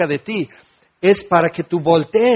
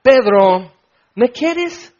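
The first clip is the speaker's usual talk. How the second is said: Jesus asking; 5 semitones higher, 3 dB louder, much slower than usual. Acted. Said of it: "Jesús le pregunta".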